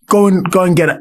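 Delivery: imitating a no-nonsense teacher; stern teacher. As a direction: order the pupil off like a stern teacher. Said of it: "he'd be like".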